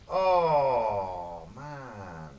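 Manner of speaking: ordinary talk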